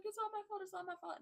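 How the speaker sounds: high, mock whiny wail; mock Vanilla Bean whining it's her fault; whiny self-blame. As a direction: ham up her guilt trip in a high voice. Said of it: "and then she's just like".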